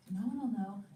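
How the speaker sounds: normal talking voice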